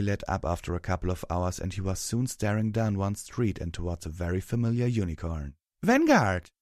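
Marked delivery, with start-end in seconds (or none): 5.8-6.5 high, loud call. A narrator's reading. Twilight hails someone down the street, calling out. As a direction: call out sharply higher and louder. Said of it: "Twilight called out".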